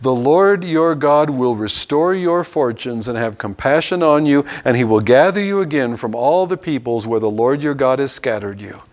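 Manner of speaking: normal talking voice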